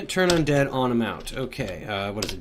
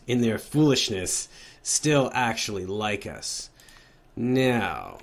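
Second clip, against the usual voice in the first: ordinary talk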